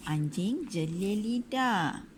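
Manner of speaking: ordinary delivery, nothing marked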